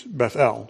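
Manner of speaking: Bethel stressed on the final EL; slow Bethel, leaning on the EL; stress on last syllable. Shown as a demonstration. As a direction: put the weight on Bethel's second syllable. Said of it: "more emphasis on God than".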